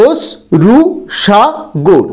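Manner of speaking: অশ্রুসাগর split slowly into অশ-রু-সা-গর; syllable by syllable. Shown as a demonstration. Teacher splitting a word into syllables for students. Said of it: "এখানে ওস এবং গর হচ্ছে রুদ্ধ দল".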